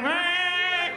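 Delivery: high, loud mock moo; hammed-up cow cry; cow bleat. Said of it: "Thing was like".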